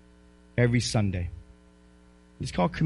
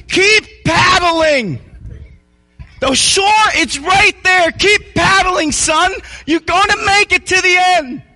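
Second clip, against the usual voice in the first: higher, louder coaching shout, slow and firm; urging on; 13 semitones higher, 6 dB louder, much slower than usual. Retold or imitated. Dad shouting encouragement to his son in the pool, loud urging. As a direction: push him to keep going.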